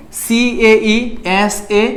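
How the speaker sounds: very slow, letter by letter; slow spelled letters. Shown as a demonstration. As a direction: spell it out slowly for students. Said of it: "মানে ভেঙে ভেঙে পড়বা". (খুব ধীরে বানান করে)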